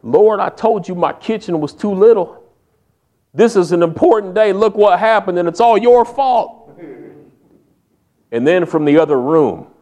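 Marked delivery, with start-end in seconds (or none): 0.0-6.7 higher, rising, pleading complaint; upset complaint to God. Retold or imitated. Wife blaming the Lord over the dropped turkey, rising, pleading. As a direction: wail it up at God, blaming him. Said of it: "And she says".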